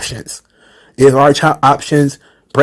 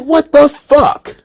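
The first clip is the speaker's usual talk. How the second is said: disbelief; 12 semitones higher, much slower than usual. Retold or imitated. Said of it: "I was like".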